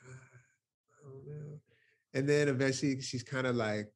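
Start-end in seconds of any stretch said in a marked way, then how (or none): 0.1-1.6 slow, faint and unsure, barely there; retelling a grieving woman's faltering reply, hushed and slow; hesitant murmur. Retold or imitated. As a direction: let the words drag out softly as if she can't answer.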